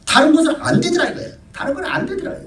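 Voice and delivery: very high, laughing through the point; preacher laughing as he insists nothing else works; laughing insistence. Real, not acted. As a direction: chuckle while hammering it home.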